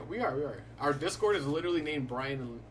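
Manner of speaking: usual speaking voice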